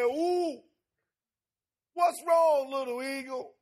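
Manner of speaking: high, loud hooting owl calling out to the eagle; sermon story owl, high and loud; wise owl voice. Acted. Owl repeats the question.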